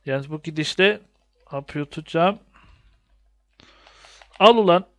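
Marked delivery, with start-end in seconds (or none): 4.4-4.9 loud, punchy take that; triumphant shout. Real, not acted.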